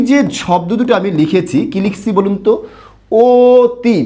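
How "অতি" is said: অতি with the first vowel stretched as ও; drawn-out অতি. Shown as a demonstration. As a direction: say অতি long, the অ sounding like ও. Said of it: "আমার সাথে এইটুকু দীর্ঘ করে বলবেন".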